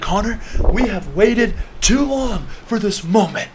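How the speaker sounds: high, slow, intense declaration; hammed-up movie-hero speech to a friend; dramatic mock plea. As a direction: plead fiercely, stretching each word. Acting out plea while dunking friend.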